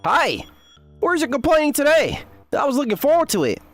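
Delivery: high, lively greeting; excited calling out. Acted. Neighbor greets the bickering couple about their complaining, excited calling out. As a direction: call out eagerly, pitched right up.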